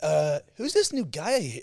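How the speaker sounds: high, loud mock American gushing to Amber; American accent. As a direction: play a chirpy American gossip. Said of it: "the way Americans pronounce the name Craig".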